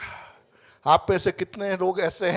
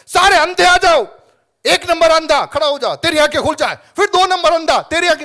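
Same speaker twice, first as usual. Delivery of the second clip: mocking healer; 8 semitones higher, 11 dB louder than usual. Mocking healer calling blind.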